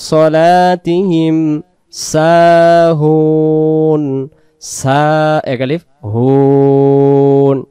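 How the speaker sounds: সাহুন with হুন held long; slow recitation holding সাহুন; long sahun. Shown as a demonstration. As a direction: stretch সাহুন's last vowel then breathe. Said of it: "টানে দম ছেড়ে দেব".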